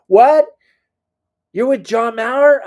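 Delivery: wife gasping down the phone; shocked. Retold or imitated. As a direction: jump out of your seat in disbelief.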